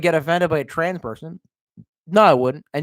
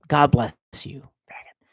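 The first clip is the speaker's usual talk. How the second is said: muttered blessing; 3 semitones lower than usual. Pretend. Streamer correcting a curse at a viewer, muttered. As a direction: trail off into a whispered insult.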